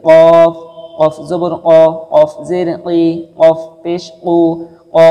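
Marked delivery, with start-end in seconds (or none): 0.1-0.5 কফ with a loud echoing bounce at the end; throat pressed, কফ bounced out loud; qalqala bounce. Shown as a demonstration. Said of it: "গলাটা একটু চেপে আসবে". (কফ কলকলা করে জোরে উচ্চারণ)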